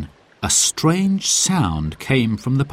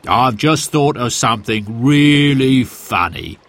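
chuckling, amused voice; laughing; 3 semitones higher, 3 dB louder than usual. A narrator's reading. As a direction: laugh through the words. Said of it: "said Sid".

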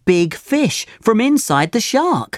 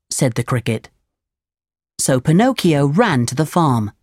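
ordinary delivery, nothing marked

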